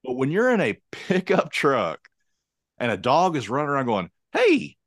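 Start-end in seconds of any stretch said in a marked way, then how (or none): 4.3-4.8 high, bouncy happy dog greeting; mock dog saying hey, pitched way up; excited dog voice. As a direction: play the dog calling out a friendly hey.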